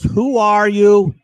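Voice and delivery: imitated demand, slow, high and loud; retold insistent challenge. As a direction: draw out the lady's challenge loudly. Retold lady challenges the voice again.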